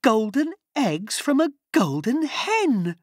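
mother bursting with joy; overjoyed cry. A narrator's reading. Mother seeing the gold Jack brought home, high joyful cry. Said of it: "cried Jack's mother".

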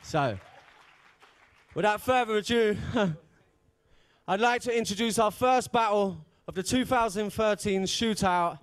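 usual speaking voice

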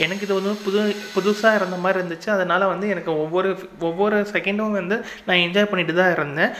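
usual speaking voice

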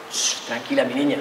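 low, soft reassurance; quietly coaxing, imitated boyfriend; soothing hush. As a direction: hush her tenderly, voice dropped right down. Acted boyfriend hushing her.

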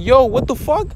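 high-pitched shocked yelp, imitating himself; shocked outburst. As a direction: blurt it out like you can't believe what you just saw. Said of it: "I was like".

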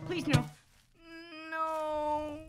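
high, loud, panicked squeaky pleas; pleading slime voice. Pretend. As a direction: beg like a terrified little slime.

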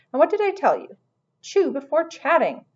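high, nagging reproof; telling off. A narrator's reading. Tilly correcting Henry's table manners, mildly scolding.

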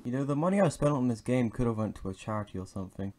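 usual speaking voice

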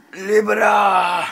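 stretched-out heroic yell; hammed-up heroic shout. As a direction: bellow it out like a movie hero charging in. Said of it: "I'll just talk like that".

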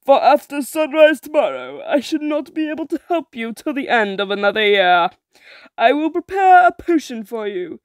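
menacing hag spelling out the price; sea witch voice. Acted. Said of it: "said the witch".

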